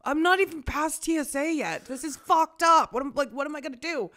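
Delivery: high, loud mock panic; retold panic at the airport security line; panicked. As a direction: freak out over the phone. Panicked retold phone call.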